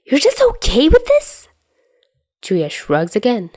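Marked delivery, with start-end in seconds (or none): 0.0-1.5 very high, louder disbelieving question; can't believe what he hears; incredulous. Acted. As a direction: Dazai to Chuya, shrill-free high disbelief. Dazai incredulous.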